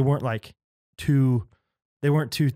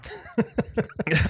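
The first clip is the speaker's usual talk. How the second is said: breathy laugh; 8 semitones higher, 4 dB quieter than usual. Real, not acted. Laughing at the reading.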